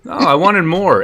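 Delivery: ordinary talk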